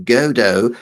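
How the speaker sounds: Godot as GO-doh, silent T; long O in both halves of Godot, GO-doh; go-doh pronunciation. Shown as a demonstration. Listing valid ways to say it, Godot as GO-doh. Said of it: "however you wanna pronounce it".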